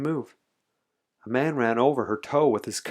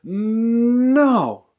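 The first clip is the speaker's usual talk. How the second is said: loud, high, drawn-out NO; huge defiant holler; group yell; 11 semitones higher, 8 dB louder than usual. A narrator's reading. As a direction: build on the N and burst out. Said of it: "all yelled".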